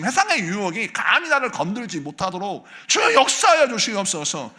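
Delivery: loud, high, impassioned pleading; fervent shouted prayer; furious prayer. Shown as a demonstration. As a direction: cry out to God in holy anger. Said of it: "막 분노하면서 기도하면서".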